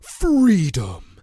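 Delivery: loud, stretched-out slogan; hammed-up rallying cry; mock patriotic cry. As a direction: belt it out in parody of flag-waving. Mocking the film's patriotism.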